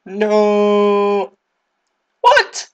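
long high no, sharp loud what; dismayed yell. Real, not acted. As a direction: shout in disbelief at losing. Character dies in game.

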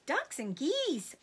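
high, drawn-out cry of delight; little foxes crying out in greedy glee; eager cry. A narrator's reading. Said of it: "cried the small foxes".

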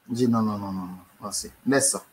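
ordinary talk, nothing marked

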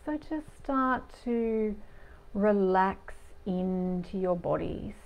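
lingering, soothing meditation delivery; guided meditation. Real, not acted. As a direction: guide listeners gently into their bodies, unhurried.

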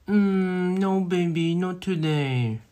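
high, drawn-out sweet voice; cooing refusal. Acted. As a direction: gently turn your baby down, stretching it out. Cutesy refusal to partner.

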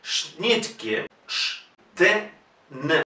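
Schnitke softly, then "Sh", T, N apart; consonant cluster. Shown as a demonstration. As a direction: isolate each consonant of Schnitke. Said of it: "Una consecución de".

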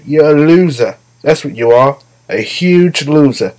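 lightly imitated dismissive politician, slowing at the end; mock politician put-down. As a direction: deliver a hammed-up insult, stretching the last words. Mock put-down echoing Trump impression.

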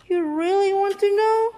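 high, loud sly tease; teasing. Acted. Bad seed teases the listener, high and sly. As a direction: lean in and dare them to ask.

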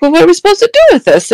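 blurting it out, half laughing in disbelief; exasperated question. Retold or imitated. Her own retold exasperated question.

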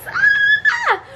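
sudden high squeal of joy; a happy yelp over good news; excited squeal. Real, not acted. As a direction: let out a real delighted shriek. Happy news about ambassador offer.

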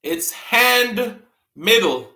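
"hand middle", H sounded, very slow; slow reveal. Shown as a demonstration. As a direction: spell out "hand middle" slowly. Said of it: "You all give up".

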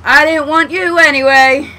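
loud, high mock-sulky yell; huffy shout. Player gives up on the rope, huffy shout. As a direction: shout it like a spurned kid.

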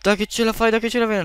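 acuto, incalzante; incitamento concitato. Real, not acted. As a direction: fai il tifo per lui col fiato sospeso. Incita il compagno in difficoltà. (tifo concitato)

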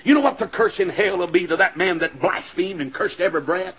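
ordinary talk, nothing marked

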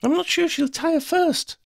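high, urgent warning; high-pitched cautioning call. A narrator's reading. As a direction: warn her in a very high voice. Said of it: "Sophie warned".

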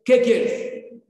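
exasperated mother. Retold or imitated.

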